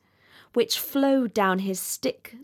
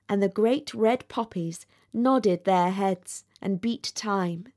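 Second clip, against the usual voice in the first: plain reading voice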